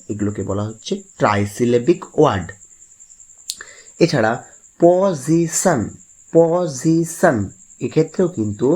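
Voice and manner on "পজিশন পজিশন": Position slow and high, in three beats: প, জি, শন; three separate syllables. Shown as a demonstration. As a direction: draw out each syllable of position. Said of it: "তিনবার বাধা পাচ্ছে".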